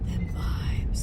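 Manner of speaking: hushed awed whisper; whispered awe, very high. Real, not acted. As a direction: breathe it out in wonder. Awed on one-lane bridge.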